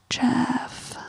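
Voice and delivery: breathy exasperated whisper; just breathe his name out. Real, not acted. Reacting to Jeff's contradiction, whispered. Exasperated aside about Jeff.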